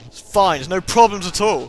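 overly loud insistence; loud reassurance. Real, not acted. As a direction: push it out, forced calm.

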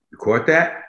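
ordinary talk, nothing marked

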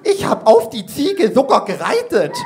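put-on wrong form gereitet said high and eager by a child; a kid blurting gereitet instead of geritten; indignant kid. Jenny boasts to the teacher, saying gereitet. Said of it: "echauffiert sich Jenny".

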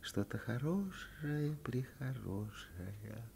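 slow, hushed, teasing drawl; sly tease. Acted. Girl teasing the boy with a secret, slow and sly. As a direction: dangle the secret slyly, softly. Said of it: "лукаво прищурила глаза".

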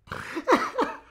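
loud, high burst of laughter; helpless voiceless laugh; wheezing laugh. Real, not acted. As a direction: explode into breathless cackling. Laughing fit continues.

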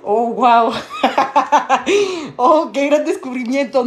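slow mock wonder, laughing; mock amazement with laughter. Reading a viewer's comment aloud, laughing mock amazement. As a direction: read it drily amazed, breaking into laughter.

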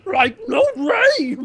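slow, high, goofy cartoon voice; cartoon impression. Joining friends' cartoon teasing, slow silly voice. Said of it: "that was more like freaking Yoda".

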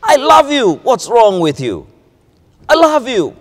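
loud, high angry shout. Shown as a demonstration. Preacher showing harsh shouted love. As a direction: bark it like a scolding. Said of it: "Or you can say".

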